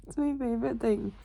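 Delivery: hushed breathy whisper, no voice. Real, not acted. Telling viewers she loves bed, whispering. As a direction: murmur it like a secret from under the covers.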